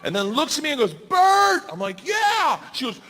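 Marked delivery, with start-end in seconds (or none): 0.9-1.6 high, loud imitated call; re-enacted urgent holler; shouted name. Shouting at plane's open door. 2.1-2.6 high-pitched yell back; re-enacted nervous shouted response; shouted answer. Shouting back at open door.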